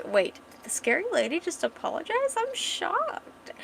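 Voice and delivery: high-pitched mock shock; smirking teasing. Man teasing the scary lady who apologized, smirking. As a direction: tease with a smirk, voice climbing very high. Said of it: "he's got a fucking smirk".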